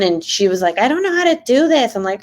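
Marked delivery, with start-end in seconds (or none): 0.8-1.9 high-pitched mock whine; whiny sister. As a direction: imitate a helpless sister, pitched up.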